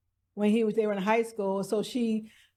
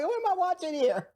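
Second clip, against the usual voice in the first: baffled; 5 semitones higher than usual. Retold or imitated. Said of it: "I'm like".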